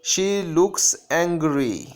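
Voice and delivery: very slow, measured reading of a grammar rule. Shown as a demonstration. Teacher dictating a grammar rule to students, very slow. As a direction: dictate the rule slowly so students can copy it. Reads example sentence.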